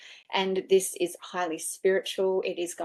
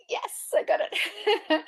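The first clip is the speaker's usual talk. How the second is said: much higher, slow drawn-out yes; mock patient reply; 14 semitones higher, much slower than usual. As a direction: humour them slowly and sweetly. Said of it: "And I'm going".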